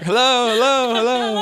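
playful high-pitched holler; mock yell down a hole. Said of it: "Do you just yell down the hole".

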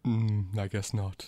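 low, slow, measured murmur. Acted. Answering co-host as the singer he mimics, creepily measured. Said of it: "that's creepy. It's like he's here".

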